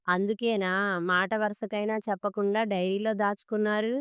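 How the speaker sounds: ordinary delivery, nothing marked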